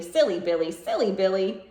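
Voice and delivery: ordinary delivery, nothing marked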